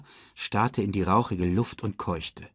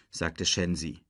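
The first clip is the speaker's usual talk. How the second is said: usual speaking voice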